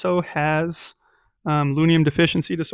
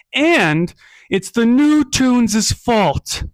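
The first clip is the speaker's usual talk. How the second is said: high, slow, loud villainous governor; scheming governor; 7 semitones higher, 5 dB louder, much slower than usual. Acted. Governor blaming the new Toons to the crowd, drawn out and loud. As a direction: drag it out like a sly politician.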